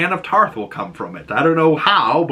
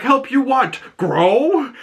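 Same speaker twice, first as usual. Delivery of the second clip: much higher put-on voice, deadpan jab; teasing Dunk; 12 semitones higher, slower than usual. Dunk teasing Egg about being small, mock voice. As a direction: toss off the joke in a hammed-up voice.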